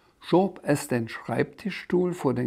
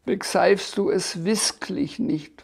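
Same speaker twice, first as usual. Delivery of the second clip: hoch und langsam, verzweifelt; verzweifelter Drache; 7 semitones higher than usual. A narrator's reading. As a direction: verzweifelt und gedehnt nachfragen. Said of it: "Der Drache war ganz verzweifelt". (kleiner Drache, hohe verzweifelte Stimme)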